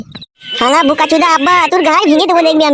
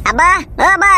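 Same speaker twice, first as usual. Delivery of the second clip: calling out; 5 semitones higher than usual. Acted. Calling father from other room.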